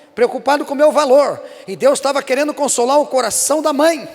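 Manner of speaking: loud, high preaching climax, building fervor; fervent preaching. Acted. Pastor preaching at the church, loud and fervent. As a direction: preach it hard to the congregation.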